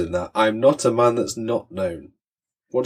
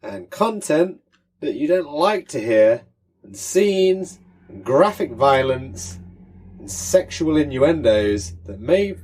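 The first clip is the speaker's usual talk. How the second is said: slow, high announcer warning; dramatic warning; 5 semitones higher, slower than usual. Pretend. Mock dramatic podcast warning.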